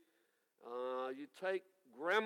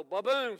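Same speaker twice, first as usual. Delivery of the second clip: thunder boom; 4 semitones higher, 9 dB louder than usual. Acted. Thunder sound joke.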